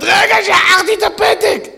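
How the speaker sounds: high, slow, loud startled cry; surprised cry. Acted. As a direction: she suddenly remembers the note and shouts. Mother suddenly remembers the note. (צעקה מופתעת, גבוהה ואיטית)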